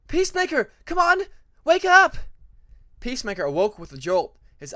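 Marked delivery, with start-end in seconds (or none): 0.1-2.2 high, loud shout to wake him; urgent shout. Acted. Tempest shouting to wake the dreaming Peacemaker, high and loud.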